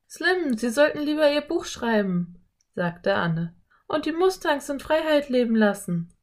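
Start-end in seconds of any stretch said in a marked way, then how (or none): 0.1-2.4 put-on high-pitched girl character; eager girl. A narrator's reading. Narrator voices the girl Anne. 3.9-6.1 put-on high-pitched girl character; eager girl. A narrator's reading. Narrator voices the girl Anne.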